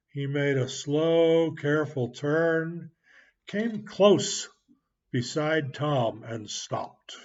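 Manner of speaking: ordinary delivery, nothing marked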